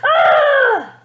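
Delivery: high, loud wordless scream; a spoilt girl's ear-splitting scream; tantrum scream. A narrator's reading. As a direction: let rip a tantrum shriek. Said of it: "let out a scream".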